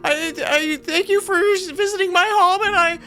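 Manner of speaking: very high, tearful rambling gush; crying starstruck fan. Acted. Bifar meets his hero Adokus, very high teary gushing. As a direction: gush through tears at your idol. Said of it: "and he starts crying".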